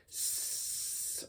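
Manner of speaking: hissed unvoiced tss like a hi-hat. Shown as a demonstration. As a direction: hiss short tss like a snake. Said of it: "like a hissing snake".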